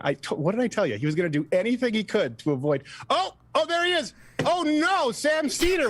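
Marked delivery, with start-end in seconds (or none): 3.1-5.9 real high-pitched alarmed "oh no"; genuine dismay. Real, not acted. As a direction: voice leaps up in dismay. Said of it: "That was the most genuine".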